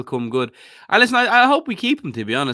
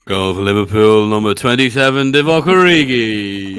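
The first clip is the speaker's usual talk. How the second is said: booming stadium announcer call; loud and drawn out, like a PA; George Sefton impression; 5 semitones lower, 8 dB louder, slower than usual. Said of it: "Can you do your best, uh, George Sefton impression?".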